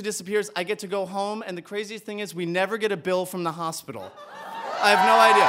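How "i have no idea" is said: loud, bursting disbelief; loud astonished outburst; amazed exclamation. Real, not acted. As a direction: throw it out to the crowd, loudly amazed. Punchline about missing hospital bill.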